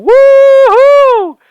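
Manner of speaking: very high, loud whoop of delight; joyful whoop. Retold or imitated. Reviewer imitating the Spider-Men leaping off Lady Liberty, ecstatic high whoop. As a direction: cheer like a swinging hero. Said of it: "jumping off of Lady Liberty and going".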